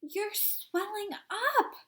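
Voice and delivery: high, slow shrill scream of alarm; a mother screaming in horror. A narrator's reading. Mother screaming at her swelling daughter, high and slow. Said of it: "screamed Missus Beauregard again".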